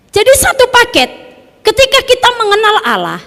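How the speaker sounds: high, loud preacher's proclamation; preaching at full force. Acted. Preacher to the church, proclaiming loud and high. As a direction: ring it out to the congregation.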